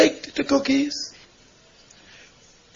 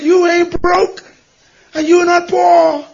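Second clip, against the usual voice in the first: slow, higher and louder, each word landed; firm emphatic rebuke; 5 semitones higher, 5 dB louder, slower than usual. Retold or imitated. Pastor tells the weeping lady she is not poor, slow and emphatic. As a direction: set her straight, word by word.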